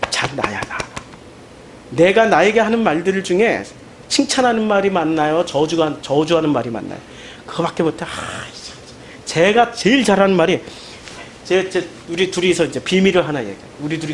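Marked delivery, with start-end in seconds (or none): none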